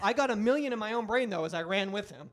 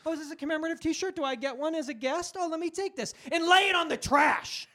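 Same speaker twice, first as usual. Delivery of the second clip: high, quick fake delight building to a loud finish; mock-innocent act; 9 semitones higher, 5 dB louder than usual. Guest accepting a commemorative T-shirt, mock-innocent then loud. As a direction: play sweetly eager, then blast the punchline.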